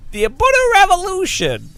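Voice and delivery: playful sponsor parody; imitating Sean Cole. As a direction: stretch the slogan out. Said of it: "I can do Sean Cole".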